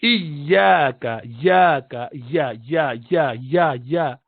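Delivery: iyaka and ya spelled out slowly, with a doubled Arabic ya; demonstrating the ya sound for a learner; tajweed demo. Shown as a demonstration. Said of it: "So you would say".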